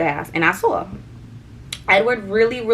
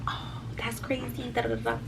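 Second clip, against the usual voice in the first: breathy hushed retelling of the friend; soft whisper quote; 3 semitones higher, 12 dB quieter than usual. Retold or imitated. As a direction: hush it like gossip. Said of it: "she was like".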